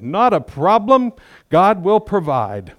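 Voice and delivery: high, slow, airy confidence; a hammed-up picture of perfect faith; mock serene faith. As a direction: sing it out like nothing could shake you. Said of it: "I'd like to tell you that I had this amazing faith".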